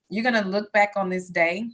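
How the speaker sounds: usual speaking voice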